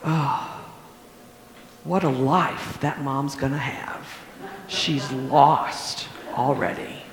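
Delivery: softly muttered to himself; soft, slow private remark; quiet aside. Retold or imitated. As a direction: drop the voice as if thinking aloud. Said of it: "I said to myself".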